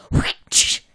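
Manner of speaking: one sharp voiceless swish; imitated whoosh noise. Shown as a demonstration. Redoing that last one for the co-host, imitated whoosh. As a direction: redo the mouth-made "whshh" effect. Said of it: "that last one".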